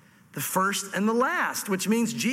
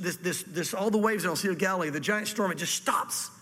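ordinary talk

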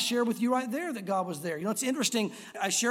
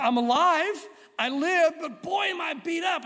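higher, louder, dazed relief; battered survivor; 7 semitones higher, 3 dB louder, slower than usual. Retold or imitated. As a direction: gasp it out like you just survived the fall. Battered man at valley bottom.

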